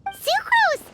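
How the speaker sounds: loud, high call across the room. Acted. Paimon calls out to Sucrose across the lab, loud and high. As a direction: call her name out, high and bright.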